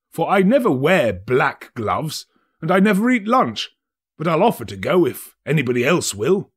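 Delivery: boasting of his friendship lightly; a hint of a smug city merchant; merchant, glib.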